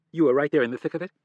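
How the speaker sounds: loud, fast outburst; incredulous. A narrator's reading. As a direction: fire it back in disbelief. Wife realizes the lie.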